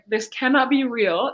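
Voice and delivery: ordinary talk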